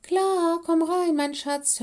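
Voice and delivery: high, loud motherly call; calling from next room. A narrator's reading. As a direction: call back cheerily from the office. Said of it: "hörten sie Madame Kitty aus dem Büro".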